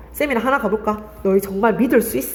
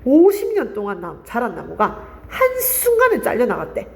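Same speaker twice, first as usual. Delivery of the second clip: teacher's demo stressing 오십 년 and 한순간에; stress on key phrases. Shown as a demonstration. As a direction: punch 오십 년 and 한순간에. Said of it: "어디를 강조하면서 읽어야 돼?".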